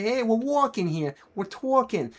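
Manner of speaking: put-on New York accent, rounded aw vowels. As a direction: do a New Yorker with "wawking" and "cwawfee". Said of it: "New Yorkers do the same thing too".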